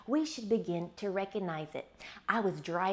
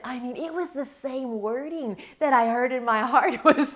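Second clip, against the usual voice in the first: amused, slightly raised and louder; laughing while telling; 4 semitones higher, 7 dB louder than usual. Real, not acted. Marveling that Google matched her heart, laughing. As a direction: let a laugh slip through the words.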